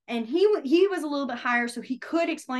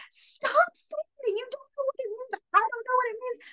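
exasperated pleading; 13 semitones higher than usual. Retold or imitated. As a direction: beg him to stop, voice up high. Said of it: "And I was like".

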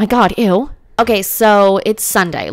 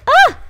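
sudden high, loud yelp; short squeal, pitched way up; 7 dB louder than usual. Real, not acted. Startled yelp at the game.

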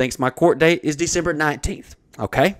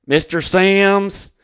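raised, drawn-out name call; court official calling; 6 semitones higher, 5 dB louder, much slower than usual. Retold or imitated. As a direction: call the next case slowly and loudly. Court official calls the next name.

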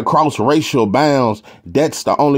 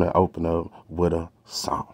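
whisper; 6 semitones lower, 10 dB quieter than usual. Real, not acted. Whispered lead-in before singing.

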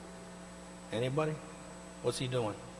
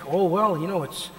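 naive believer; 7 semitones higher than usual. Retold or imitated. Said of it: "And if you just think".

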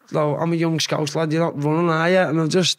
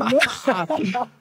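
high burst of laughter; real laugh; 7 semitones higher than usual. Real, not acted. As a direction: laugh it off, tickled by the memory. Laughs after his story.

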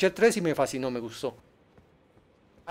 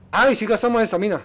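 usual speaking voice

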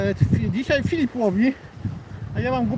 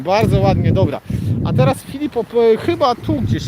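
usual speaking voice

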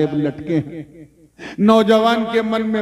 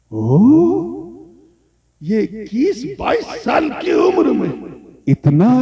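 amazed exclamation; 4 semitones higher, slower than usual. Retold or imitated. Old owner amazed at the young man, astonished.

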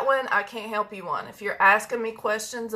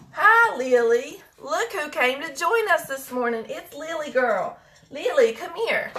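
higher, sing-song greeting to a pet dog; 7 semitones higher than usual. Real, not acted. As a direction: coo at the little dog.

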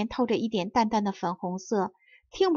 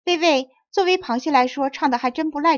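lightly voiced head crab, higher and louder; 5 semitones higher, 4 dB louder than usual. A narrator's reading. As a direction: call out as the crabs' leader, raising an objection. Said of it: "传来了那个头领螃蟹的声音". (头领螃蟹略微抬高嗓门)

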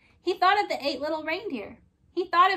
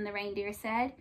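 ordinary delivery

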